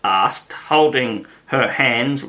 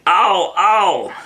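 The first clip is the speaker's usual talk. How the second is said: higher, louder pained cries; crying out; 7 semitones higher, 3 dB louder than usual. A narrator's reading. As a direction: yelp out in advance. Queen screaming again.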